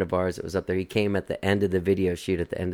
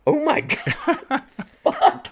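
very high, stretched-out words dissolving into laughter; helpless laughing disbelief; much slower than usual. Retold or imitated. Two eavesdroppers react to the weird conversation, laughing disbelief. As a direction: gasp it out while cracking up.

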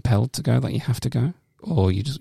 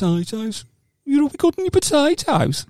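high Brummie voice, we got any potatoes; Birmingham accent. Shown as a demonstration. Showing how easy potatoes is in Birmingham accent. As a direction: put on a broad Birmingham accent, pitched high. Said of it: "easy to say in a Birmingham".

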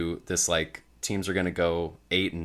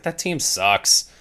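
slow, higher and louder, dragged out in disbelief; scoffing verdict; 8 semitones higher, 5 dB louder, much slower than usual. Retold or imitated. On a podcast, mocking a betting line: slow scoff. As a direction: stretch each word like it's obvious. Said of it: "And I was like".

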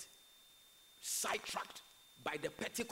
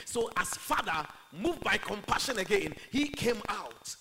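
loud, higher preacher's delivery; preaching; 5 semitones higher, 11 dB louder than usual. Acted. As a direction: proclaim it forcefully to the congregation. Preaches at full force.

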